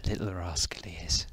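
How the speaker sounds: hushed whispered aside; soft unvoiced murmur. Real, not acted. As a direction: whisper it so as not to disturb. Whispered aside about a pet.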